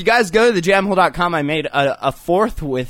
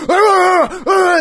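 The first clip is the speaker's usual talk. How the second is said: shouting; 14 semitones higher, 7 dB louder than usual. Retold or imitated. Yelling at dogs in cars.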